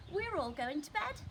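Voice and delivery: put-on high, bright child; child Lester. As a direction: announce it proudly like a small boy. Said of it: "said Lester".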